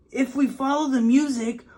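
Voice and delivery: put-on high, eager boy voice; child voice. A narrator's reading. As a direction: say it like a hopeful young kid. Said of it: "said Bob".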